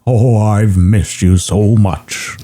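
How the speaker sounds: slow, drawn-out put-on dog voice; Shadow the dog. Dog greeting the cat he missed, slow hammed-up voice. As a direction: draw it out like a hammed-up reunion. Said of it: "And Shadow says".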